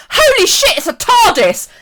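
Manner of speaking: loud, high re-enacted yell of amazement; excited outcry. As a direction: blurt it out at a sudden thrilling sighting. Retold excited sighting from car.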